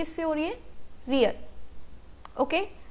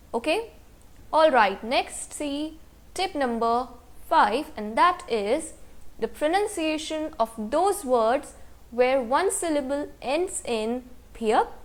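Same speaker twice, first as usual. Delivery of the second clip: ordinary delivery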